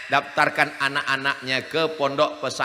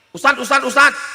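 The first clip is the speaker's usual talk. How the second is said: calling out; 8 semitones higher, 5 dB louder than usual. Acted. Congregant calling the preacher.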